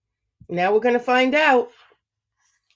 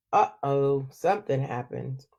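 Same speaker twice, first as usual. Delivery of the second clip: low, soft suspense; hushed uh-oh; 9 semitones lower, 7 dB quieter than usual. A narrator's reading. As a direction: drop low to build suspense. Suspense for children.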